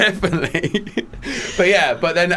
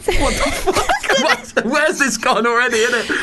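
laughing; 8 semitones higher, slower than usual. Real, not acted. Laughs at own rambling.

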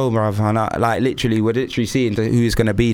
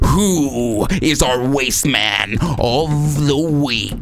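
announcer voice; much slower than usual. Acted. Announcing the winner on air.

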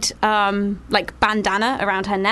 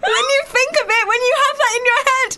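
high-pitched disgust; 12 semitones higher than usual. Real, not acted. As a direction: squeal in horror and disgust. Disgusted by bone thought.

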